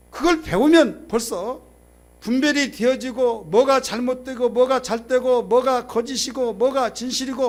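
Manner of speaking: loud, high-pitched pulpit proclamation; forceful preaching. Real, not acted.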